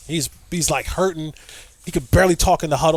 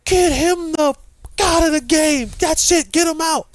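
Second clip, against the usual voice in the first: raised mock-yell from the sideline; coach screaming; 11 semitones higher than usual. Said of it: "I can hear him screaming".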